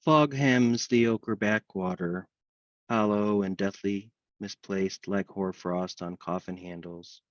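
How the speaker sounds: measured, even recital in long lines; poem reading. Acted. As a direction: intone the verse steadily, pausing between lines. Host recites a dog poem.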